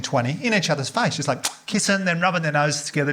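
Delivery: ordinary talk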